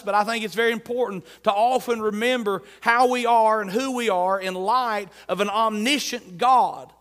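usual speaking voice

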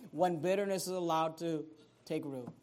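low, soft preaching voice; solemn warning. Real, not acted. Preacher warning the church about bitterness, solemn and hushed. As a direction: bring it right down and warn gravely.

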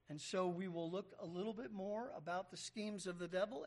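usual speaking voice

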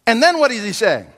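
usual speaking voice

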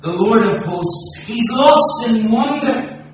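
loud, high, ringing preacher's build to a climax; impassioned pulpit proclamation, every line driven hard; full-force preaching. Acted. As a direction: preach it out to the whole hall.

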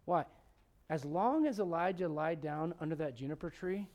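normal talking voice

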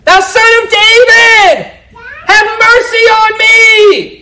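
high, slow, loud pleading cry; a beggar's long, drawn-out cry for mercy. Retold or imitated. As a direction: call out desperately from the roadside. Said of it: "He began to cry out and say".

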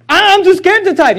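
high whiny excuse; mock scared excuse. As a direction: play the frightened excuse-maker. Mocking a congregant's excuse.

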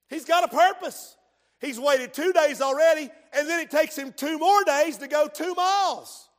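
loud, high, driving preacher's cry; full-force preaching. Acted. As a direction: build it up and hammer the point.

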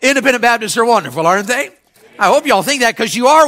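"Independent Baptists are wonderful" with a lifted, rising "aren't they"; upbeat retry. Shown as a demonstration. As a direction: sell it to the crowd this time. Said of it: "Let me try that again".

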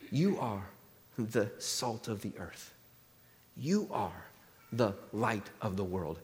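low, hushed and slow, with weighty pauses; solemn pronouncement. Acted. Jesus addresses his followers on the hillside, hushed and solemn.

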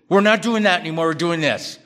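loud, fast, bossy announcement; abrasive decree. Retold or imitated. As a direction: bark the new rule at the family. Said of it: "Don't do it that way".

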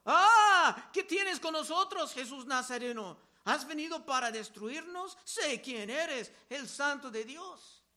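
a possessed man shrieking at Jesus; demon crying out. Acted. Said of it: "un hombre con espíritu inmundo que dio voces diciendo". (grito de endemoniado, mucho más alto y fuerte al inicio)

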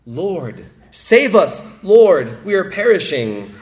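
loud, high plea, voice straining; desperate cry. Acted. Disciples crying out to the sleeping Lord, desperate loud plea. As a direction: shout it like drowning men.

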